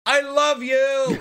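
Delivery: high, drawn-out mock German love call; sweet but loud. Host mocking how sweet talk sounds in German, sweet and loud. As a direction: bellow tender words in a mock German manner. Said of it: "can't say anything sweet and loud".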